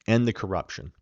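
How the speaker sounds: usual speaking voice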